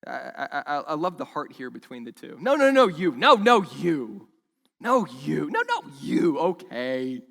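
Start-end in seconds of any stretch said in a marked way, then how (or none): 2.4-7.2 mock polite insisting. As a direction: ham up two men insisting the other take it. Acting polite refusal back and forth.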